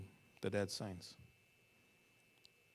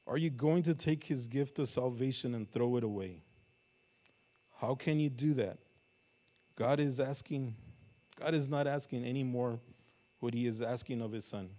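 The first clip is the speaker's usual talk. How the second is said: raised, urgent sermon voice; fervent preaching; 6 dB louder than usual. Acted. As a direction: drive the appeal home to the church. Preaching at full force.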